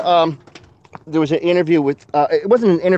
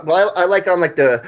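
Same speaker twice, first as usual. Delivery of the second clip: normal talking voice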